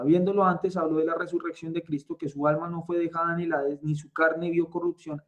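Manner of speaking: brisk, run-on reading of scripture; quick read-through. Real, not acted. Teacher rereading a verse just covered, quick read-through. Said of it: "lo voy a leer rápido".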